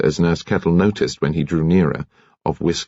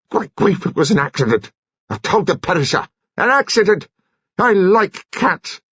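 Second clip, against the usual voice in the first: high, strained protest of a shaken man; distressed commander; 12 semitones higher than usual. Acted. Commander defends himself to the nurse, high and upset. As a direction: plead it was an accident, close to tears. Seiss upset about the cat.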